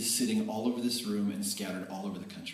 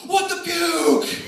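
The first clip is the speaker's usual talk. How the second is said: much higher, louder and slow, laughing; stunned exclamation; 11 dB louder, much slower than usual. Retold or imitated. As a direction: blurt it out in amazed disbelief. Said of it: "he's like".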